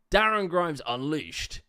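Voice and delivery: loud, slow attempt at a northern accent; northern English accent. Commentator mimicking the politician's shtick, hammed-up northern accent. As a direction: have a go at a northern English announcer. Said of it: "how do you do a fucking northern accent".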